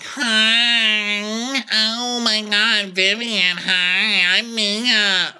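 high, drawn-out gushing greeting; exaggerated high-pitched hostess impression; Mia. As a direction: squeal hello, stretching every word in parody.

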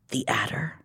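hushed dread whisper. Acted. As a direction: breathe the name out. Reading the dread reveal.

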